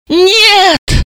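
high, desperate cry; shout. Acted. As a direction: scream no in despair. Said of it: "закричала Далила".

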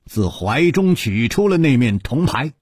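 ordinary delivery, nothing marked

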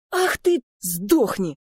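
high, loud burst of rage; furious yell. A narrator's reading. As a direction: explode at a taunting brother. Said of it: "завопил раскрасневшийся Лео". (яростный вопль)

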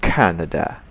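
"Canada" with a breathy kh; right "Canada"; aspirated k. Shown as a demonstration. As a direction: puff the first k of "Canada". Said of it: "tapi k".

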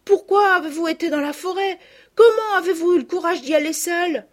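put-on excited little girls. The cousins pepper the returned girls with questions, high and excited. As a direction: burst out with questions, overjoyed.

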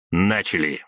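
plain reading voice

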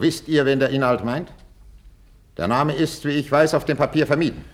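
loud, high, pressing questioning; angry interrogation. Acted. King cornering the duke over the letter, angry interrogation.

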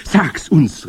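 high, loud eager plea; excited crowd cry; calling out. A narrator's reading. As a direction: beg loudly as the witch audience. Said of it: "riefen sie".

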